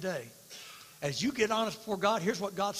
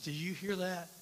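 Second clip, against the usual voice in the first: usual speaking voice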